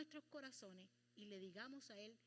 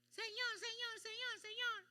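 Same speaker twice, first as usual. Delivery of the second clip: high, loud, frantic calling; desperate crying out; 9 semitones higher, 7 dB louder than usual. Pretend. People in trouble desperately calling on the Lord. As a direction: beg God in panic. Desperate person with rope round neck.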